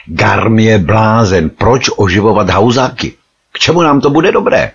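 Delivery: loud, high-pitched angry outburst; rising fury. A narrator's reading. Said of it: "Začínal vypadat velmi rozzuřeně". (rozzuřeně, zvýšeným hlasem)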